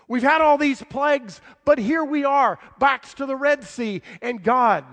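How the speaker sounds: loud, high, impassioned preaching; full-force sermon voice. Acted. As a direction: drive the story home to the congregation.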